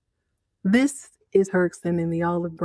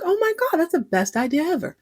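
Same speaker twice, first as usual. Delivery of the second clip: much higher, gushing delight; excited squeal; 9 semitones higher than usual. Retold or imitated. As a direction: squeal it like a thrilled wife. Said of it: "And she's like".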